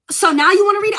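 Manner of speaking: high, loud mocking take on the officer. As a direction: throw his question back at him, incredulous. Officer mocked, quoted in disbelief.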